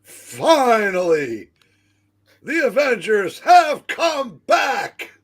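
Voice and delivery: loud, high, drawn-out hype; announcer's shout. Acted. Hyping the Avengers' return, hammed-up shout. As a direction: bellow it like a ring announcer.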